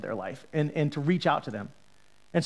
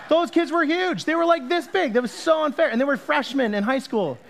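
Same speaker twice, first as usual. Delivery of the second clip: raised, loud indignant complaint; mock outrage; 10 semitones higher, 5 dB louder than usual. Real, not acted. Pastor to congregation, mock outraged complaint. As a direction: protest how unfair it was. Indignant about unfair huge kids.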